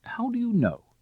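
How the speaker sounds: high, sharply lilting question; amused query. Acted. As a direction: toss it back lightly, amused.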